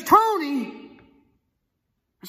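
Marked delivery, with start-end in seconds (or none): 0.0-1.0 imitating a caller yelling back; high, loud questioning shout; shouted query. Caller checking who answered on a bad line, shouting. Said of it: "it's difficult reception".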